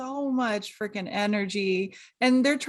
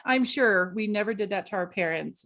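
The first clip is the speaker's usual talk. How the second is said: usual speaking voice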